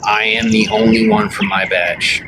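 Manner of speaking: usual speaking voice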